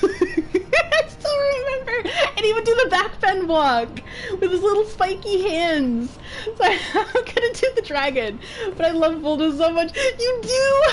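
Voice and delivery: high, laughing, half crying; laughing near tears. Real, not acted. Gushing to a friend about Voldo, laughing and teary. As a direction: laugh till you almost cry.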